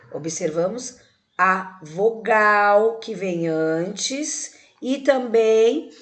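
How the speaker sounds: very slow, syllables stretched; slow dictation. Shown as a demonstration. Teacher gives children the answer to write, slow dictation. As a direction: dictate it slowly, holding each word.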